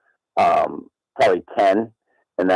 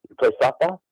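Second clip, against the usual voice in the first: slightly squeezed, higher quoted voice; lightly voiced doctor, pitched up; imitating the doctor; 6 semitones higher than usual. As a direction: ask it as the doctor, a little high. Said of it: "he goes".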